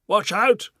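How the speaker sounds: high, loud alarmed shout; Duke shouting warning. Acted. Duke leaping back from the bang, alarmed shout. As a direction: yell the warning. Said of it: "the Duke shouted".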